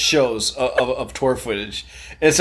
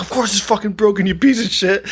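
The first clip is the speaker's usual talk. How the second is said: mock scream; 6 semitones higher than usual. Roommate losing his mind over broken printer, mock scream. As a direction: imitate someone going off the hinges.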